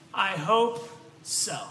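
slow, deadpan "I hope so"; dry reply. Retold or imitated. Friend's text answering the late preacher, slow deadpan. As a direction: drop it slowly and dry. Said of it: "he texts me back".

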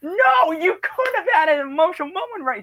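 very high retold wail of protest; hammed-up high-pitched disappointment; dismayed protest. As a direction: cry out like a cheated fan. Retold protest at missed moment.

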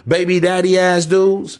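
ordinary talk, nothing marked

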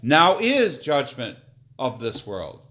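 loud, slow proclamation; declaiming scripture. Acted. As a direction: announce the judgment.

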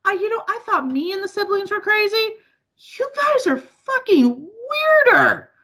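high-pitched, mock-outraged retelling; retold exasperated disbelief. Daughter retelling what she told mom, exasperated. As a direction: pitch it up, slowing onto the punchline.

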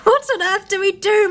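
high, loud, near-crying question; a boy in a panic; panicked plea. Acted. Peter panicked.

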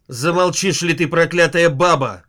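loud barked order; barking. Acted. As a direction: bark at her to shut up. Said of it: "гаркнул Троцкий".

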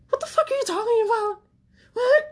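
very high, loud, incredulous shriek; the inner voice reacting to the friend's advice, a shrill disbelieving cry; shocked outburst. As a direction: shriek it like you can't believe your ears. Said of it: "that's what I was thinking".

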